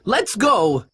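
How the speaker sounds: high, drawn-out alarm; urgent warning. A narrator's reading. Man warns the band the law is coming, urgent.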